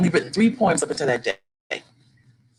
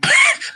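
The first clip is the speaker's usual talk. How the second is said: delighted high laugh; 5 dB louder than usual. Retold or imitated. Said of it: "and I'm like".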